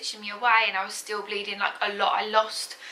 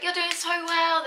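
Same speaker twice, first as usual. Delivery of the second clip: high, slow encouragement; supportive; 7 semitones higher than usual. Retold or imitated. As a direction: cheer her on, drawing it out. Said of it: "They were so supportive".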